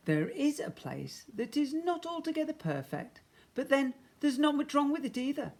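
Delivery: high, mysterious stranger's voice; shopkeeper voice. A narrator's reading. Shopkeeper to the lost spaceman, high and light. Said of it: "said the man".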